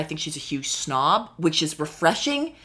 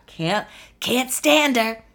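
slow, higher, fed-up groan; exasperated; 7 semitones higher, slower than usual. Real, not acted. Ranting to listeners about a hated housewife, fed up. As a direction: drag it out in disgust. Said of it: "exasperated at Erica".

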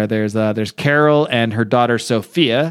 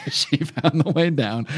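laughing repeat; 2 semitones higher, 4 dB quieter, slower than usual. Real, not acted. Joke about a character's fate.

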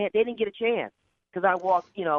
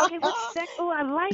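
pitched high, cooing with delight; giddy squeal; 9 semitones higher than usual. Real, not acted. Guest cooing at the host's insight, high giddy squeal. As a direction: coo it up high, thrilled.

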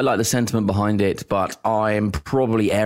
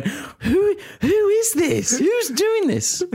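very high, slow mock bafflement; baffled tough players; much slower than usual. Rough opponents staring at the posh teammate, high mock bafflement. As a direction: gape at the posh kid in disbelief. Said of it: "they're just looking at him going".

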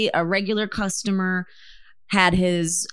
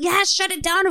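raised-pitch imitation of blunt experts; mock exasperation; 11 semitones higher than usual. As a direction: toss it out as an exasperated verdict. Voicing scientists' exasperated verdict.

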